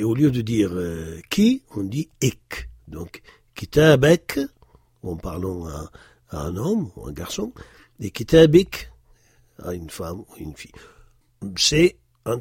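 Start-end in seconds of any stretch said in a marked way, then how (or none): 3.5-4.4 kitabek, vowel moved before the k, said louder; "kitabek" stressed, e before the final k; dialect pronunciation. Shown as a demonstration. Teacher demonstrating the dialect form, loud and clear. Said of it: "on déplace la voyelle". 8.1-8.9 kitabik, i before the final k, said louder; "kitabik" stressed, i before the final k; dialect pronunciation. Shown as a demonstration. Teacher demonstrating the feminine dialect form, loud and clear. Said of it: "on déplace la voyelle".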